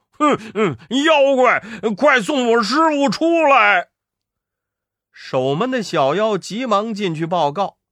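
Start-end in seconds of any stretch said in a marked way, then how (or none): 0.1-3.9 八戒粗声大喊叫阵; 冲着洞口大声叫骂. Acted. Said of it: "叫道". (大声叫阵)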